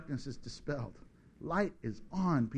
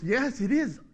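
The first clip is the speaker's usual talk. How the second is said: Dudley Moore joking; 4 semitones higher than usual. Retold or imitated. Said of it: "he goes".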